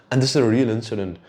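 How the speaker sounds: ordinary talk, nothing marked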